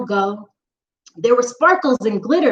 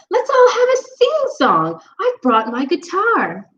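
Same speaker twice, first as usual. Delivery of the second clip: high, bubbly pumpkin voice; cheerful pumpkin; 7 semitones higher than usual. Acted. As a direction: suggest a sing-song with glee.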